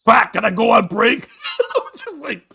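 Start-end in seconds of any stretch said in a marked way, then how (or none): 0.1-1.3 imitating a Russian coworker, loud and higher; blurted out loud. Russian coworker interrupting the moment of silence, blurted out loud. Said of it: "just out loud goes".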